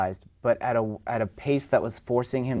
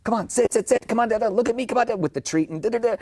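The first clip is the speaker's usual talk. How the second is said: high, pleading treat-waving chatter; frantic owner; 12 semitones higher than usual. Retold or imitated. Owner pleading with dog over treat, high frantic chatter. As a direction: mimic a flustered owner begging the dog. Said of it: "not be like".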